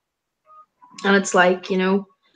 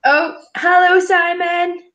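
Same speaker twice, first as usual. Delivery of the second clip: much higher, bright greeting; delighted hello; 8 semitones higher, 3 dB louder, slower than usual. Real, not acted. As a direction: greet him like a welcome friend. Greeting a chat viewer.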